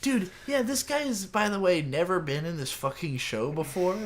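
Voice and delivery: high-pitched, worked-up disbelief; incredulous outburst. Real, not acted. Answering a co-host about an unknown character, incredulous. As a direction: burst out high, then come down gradually. Incredulous reaction to co-host's question.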